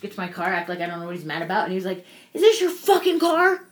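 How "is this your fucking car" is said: high, loud furious yelling at a stranger; retold angry yell. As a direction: bellow it like an enraged neighbor. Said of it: "he's like, yelling at me the whole time".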